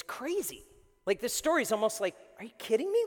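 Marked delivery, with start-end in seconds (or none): 2.4-3.0 high, louder disbelief; incredulous. Acted. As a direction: exclaim it as if the story can't be true. Reacting to the unbelievable story.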